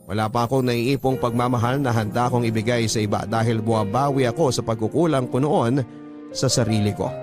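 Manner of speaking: usual speaking voice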